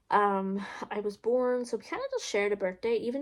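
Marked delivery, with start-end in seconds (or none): none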